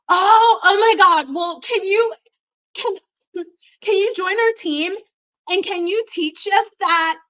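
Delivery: high, loud gushing plea; excited. Retold or imitated. Friend begging her to join the cheer team. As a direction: squeal it like a thrilled cheerleader. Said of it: "and she was like".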